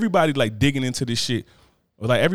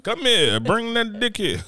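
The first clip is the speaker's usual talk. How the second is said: high, slow teasing summons; hammed-up wife luring him over; mock seductive wife; 10 semitones higher, slower than usual. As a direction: purr it high and drawn out. Acting out wife's bedroom invite.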